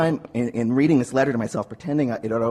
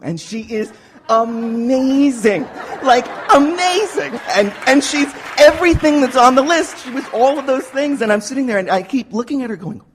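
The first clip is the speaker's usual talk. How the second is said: much higher, laughing with thrilled disbelief; giddy disbelief; 9 semitones higher than usual. Real, not acted. Telling the group about the woman who loved him, giddy and laughing. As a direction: gush like you still can't believe your luck. Retelling a stunning love confession.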